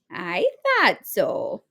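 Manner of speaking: slow, warm smiling granny; pleased she was right; knowing smile. A narrator's reading. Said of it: "said with a smile".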